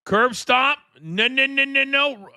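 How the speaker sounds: high, urgent no no no; wrestling commentator. Real, not acted. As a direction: cry out at the ringside call. Calling the match live.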